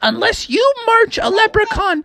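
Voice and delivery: very high, insistent mom voice; demanding mother. A narrator's reading. Mom challenging her son to bring a leprechaun, high and insistent. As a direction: lay down terms in a shrieky high voice, slowing at the end.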